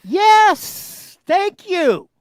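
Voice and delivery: high, loud burst of agreement; sudden high-pitched shout; yelling. Real, not acted. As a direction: yell it out in emphatic vindication. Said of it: "Stop yelling at me".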